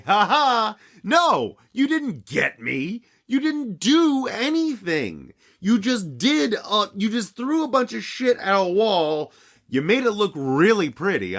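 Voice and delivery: high, sneering singsong; mocking taunt. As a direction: taunt like a smug filmmaker. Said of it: "and say".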